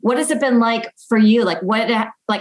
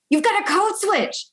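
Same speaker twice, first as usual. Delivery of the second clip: much higher mock coworker; correcting coworker; 11 semitones higher than usual. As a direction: imitate a coworker telling you off. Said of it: "someone's like".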